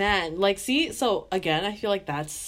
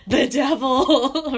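horrified parent; 6 semitones higher, 7 dB louder, much slower than usual. Retold or imitated. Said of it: "they're like".

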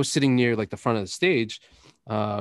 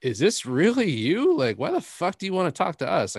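higher-pitched, starstruck incredulity; mock disbelief; 7 semitones higher than usual. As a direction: question it as if you can't believe it, acted out. Retold disbelief at a friendly celebrity.

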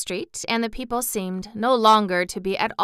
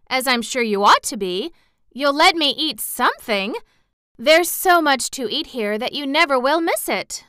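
put-on higher, louder child's voice; earnest little girl, pleading; 7 semitones higher, 5 dB louder than usual. Lost girl explaining herself to townspeople, earnest and high.

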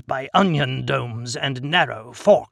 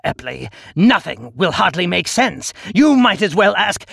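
raised, exasperated rant; 7 semitones higher, 6 dB louder than usual. Acted. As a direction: snap back in disbelief. Exasperated outburst at Epley.

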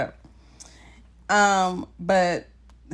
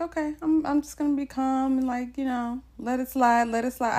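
forced calm; 8 semitones higher than usual. Retold or imitated. Deciding to keep calm.

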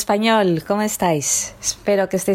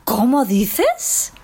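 "¿Cómo dices?" drawn out slowly and pitched higher; amazed disbelief; 4 semitones higher, much slower than usual. Shown as a demonstration. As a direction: stretch it out in astonishment. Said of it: "Con el tono expresamos la sorpresa". (sorpresa, lento y agudo)

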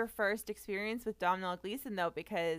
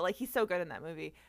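normal talking voice

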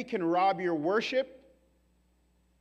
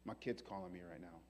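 soft, lower tender God; gentle God; 11 semitones lower, 13 dB quieter than usual. Retold or imitated. God to the angels, a soft tender aside. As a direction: speak as a caring father.

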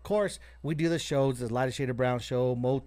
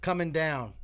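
ordinary talk

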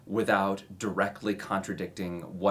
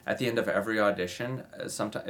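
normal talking voice

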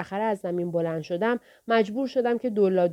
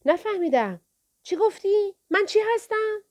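much higher, sing-song "چی گفتی"; a thug mocking the boy, hand to ear; bigger mock deafness; 12 semitones higher than usual. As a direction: push the taunt further up.

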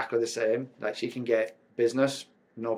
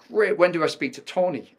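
higher, louder keen question; eager; 7 semitones higher, 5 dB louder than usual. Retold or imitated. Speaker pressing the sales rep about Tony, eager. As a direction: push to reach the star.

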